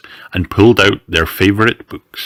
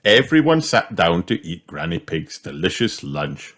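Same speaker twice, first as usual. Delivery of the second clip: ordinary delivery, nothing marked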